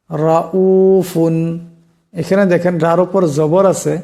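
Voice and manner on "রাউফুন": রাউফুন very slowly, র heavy. Shown as a demonstration. Teacher demonstrating রাউফুন slowly with a heavy র. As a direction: draw out রাউফুন with a thick র. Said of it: "এই রাকে মোটা আওয়াজে পড়তে হবে".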